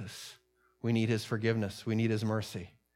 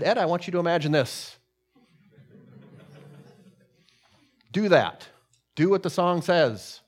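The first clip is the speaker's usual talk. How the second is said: raised, louder voice of command; firm challenge; 6 semitones higher, 7 dB louder than usual. Retold or imitated. The Lord challenges Ed on a song, firm and raised. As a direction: issue the challenge firmly, voice lifted.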